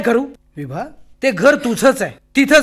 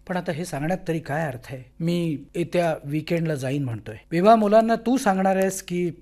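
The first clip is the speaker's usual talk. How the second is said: much softer and lower, subdued; hushed guilty pleading; 7 semitones lower, 8 dB quieter than usual. Acted. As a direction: plead softly, ashamed. Husband quietly breaking news to wife. (पत्नीची हळू आवाजात, अपराधी भावनेने विनवणी)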